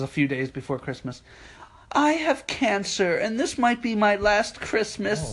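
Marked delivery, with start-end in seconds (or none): 1.9-5.3 high, slow, plaintive mock plea; milk the sob story slowly; pleading customer. Imitating a customer begging for the display tree, pitiful.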